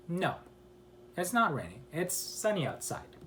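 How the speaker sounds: ordinary delivery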